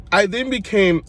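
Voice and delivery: ordinary talk, nothing marked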